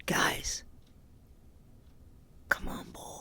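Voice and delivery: breathy hushed call to viewers; whisper. Real, not acted. Whispering to viewers as the stream opens, hushed. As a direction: whisper it before the stream starts. Whispering before going live.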